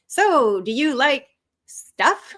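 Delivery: hesitant, stretched-out attempt at flirting; nervous small talk. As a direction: stall before a clumsy question to someone you fancy. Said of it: "nervously trying to get to know Lisa".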